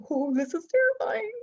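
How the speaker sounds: high, fast, giddy squeal of fear and delight; an excited shriek rising into a rushed gush; terrified but thrilled. Retold or imitated. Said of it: "You're like".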